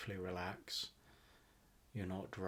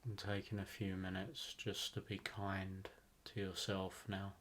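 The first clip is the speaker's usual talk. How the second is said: guided meditation; 3 dB quieter than usual. Acted. Host guiding listeners to relax into a rainforest visualization, guided meditation. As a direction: ease them into relaxation, phrase by unhurried phrase.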